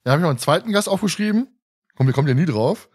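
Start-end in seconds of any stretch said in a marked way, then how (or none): none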